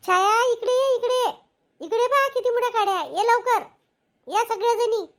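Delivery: calling out. Acted. Calling Chhaya over to the big pile of sticks, shouting. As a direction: shout for the others to come over. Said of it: "Chhaya ओरडली".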